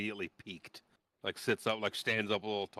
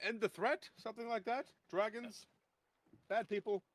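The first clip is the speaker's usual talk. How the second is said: high curious questions; 12 semitones higher, 3 dB quieter than usual. Acted. Dalamar asks eagerly.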